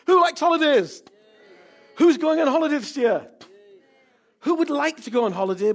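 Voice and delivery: high, loud shout to the crowd; rousing call. Real, not acted. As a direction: whip up the congregation. Rousing the congregation.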